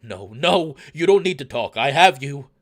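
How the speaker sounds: loud, urgent reassurance; desperate comforting. Acted. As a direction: cry out to comfort someone who is dying. Amicus hushes dying lover, acted.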